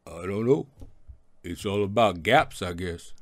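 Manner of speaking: a lightly hammed Rocky, low and loose; Rocky impression. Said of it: "That's Paulie ... and Rocky".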